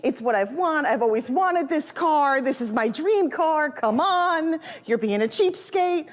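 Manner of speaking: imitating a wife's drawn-out pleading; emotional pleading. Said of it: "She goes with the emotional, convincing argument".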